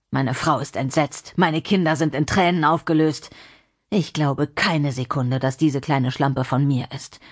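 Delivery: seethe bitterly, rising in the final accusation; bitter, angry man. Acted. John blaming Lina for losing job and family, bitter.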